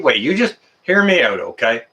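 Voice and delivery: ordinary talk, nothing marked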